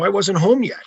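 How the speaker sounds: retold protesting innocence. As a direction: plead innocence, half in jest. Retold excuse to the coach.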